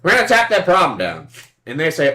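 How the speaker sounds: normal talking voice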